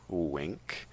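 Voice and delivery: soft, knowing aside; spoken wink. Acted. Narrator hinting at a twist, sly quiet aside. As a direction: drop it quietly like a sly hint. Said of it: "a different character".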